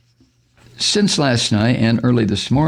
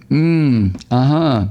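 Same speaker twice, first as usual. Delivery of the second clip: ordinary delivery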